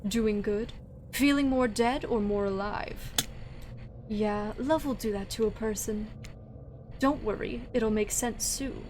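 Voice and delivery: quiet, teasing character voice; soft character voice. Acted. Voicing a game character quietly.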